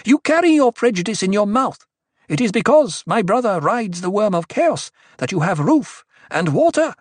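high, loud tirade; fierce priestess denouncing the crowd; snarled. A narrator's reading. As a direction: lash out at the heckler in fury. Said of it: "Aliyah snarled".

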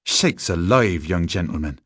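ordinary delivery

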